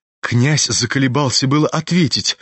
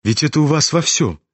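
ordinary delivery

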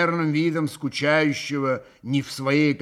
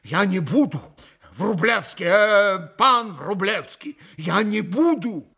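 flustered, hasty-hearted apology, faltering then rising; испуганно; 5 semitones higher, 5 dB louder than usual. A narrator's reading. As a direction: plead pardon in mild alarm. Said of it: "Митя страшно испугался". (испуганно оправдываясь)

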